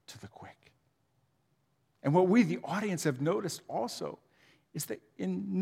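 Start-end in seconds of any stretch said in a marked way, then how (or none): 0.1-0.7 hushed breathy whisper. Real, not acted. Pastor whispers Job's pain to the congregation. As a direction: breathe the words out.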